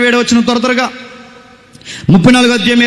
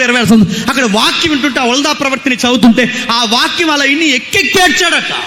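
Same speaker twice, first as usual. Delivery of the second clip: raised, driving sermon voice; fiery preaching; 3 semitones higher than usual. Acted. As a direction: preach hard at the young crowd. Preacher rebukes youth at full force. (ఉద్రేకంతో బోధించే స్వరం)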